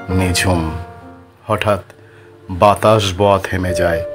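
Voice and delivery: usual speaking voice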